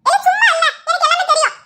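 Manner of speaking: high-pitched irritated rebuke; scolding. Acted. Grandmother tells the husband she knows everything, scolding in a high voice. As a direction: put him firmly in his place.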